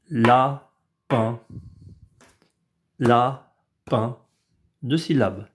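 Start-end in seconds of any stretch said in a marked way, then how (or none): 0.1-4.2 lapin split la-pin, even beats; robot syllables. Shown as a demonstration. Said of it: "pour lapin". (lapin syllabe par syllabe)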